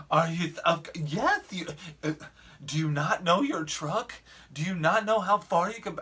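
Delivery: high-pitched, sputtering mock indignation; exasperated. Lady berating driver who backed into her, imitated as exasperated. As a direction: imitate her stammering into an incredulous scolding. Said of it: "she was exasperated".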